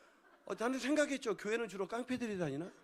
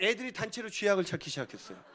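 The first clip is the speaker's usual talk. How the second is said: ordinary talk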